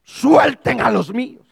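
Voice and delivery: loud, slow commanding shout. Acted. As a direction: order the release as God would. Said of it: "viene con voz de mando".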